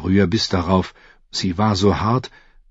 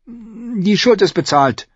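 put-on high, slow girl's concession; reluctant agreement; 13 semitones higher, slower than usual. A narrator's reading. Girl conceding to the assassin, high and reluctant. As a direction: echo it unwillingly in a high girl's voice. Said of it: "stimmte Arya widerwillig zu".

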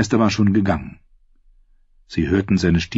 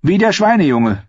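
put-on much higher, louder boyish voice; eager young novice, voice pushed up; doughy youth voiced; 11 semitones higher, 4 dB louder than usual. The pale novice offers Sam a cell, in a high boyish voice.

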